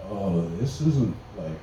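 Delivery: slow, low, hushed worry; muted dismay. Acted. Thinking to himself beside sleeping girlfriend, uneasy. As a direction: let it sink away quietly. Muttered realisation the weed is missing.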